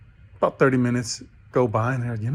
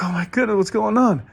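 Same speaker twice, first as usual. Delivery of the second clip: much higher and louder, baffled cry; flustered disbelief; 9 semitones higher, 5 dB louder than usual. Acted. Soaked rookie pastor after falling in again, high flustered cry. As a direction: sound stunned and flustered.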